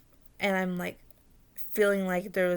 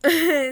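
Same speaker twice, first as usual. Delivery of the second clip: sudden loud burst of laughter; 8 semitones higher, 11 dB louder than usual. Real, not acted. Storyteller breaking off at an aside, laughing.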